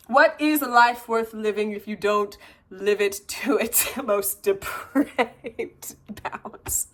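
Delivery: very slow, theatrical declamation; mock-grandiose. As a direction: stretch each word dramatically, with a laugh. Grandiose quoted answer to husband.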